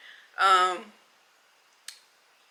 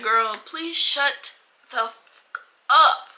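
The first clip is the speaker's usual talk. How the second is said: exasperated retort; 4 semitones higher, 4 dB louder, much slower than usual. Retold or imitated. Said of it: "it was just like".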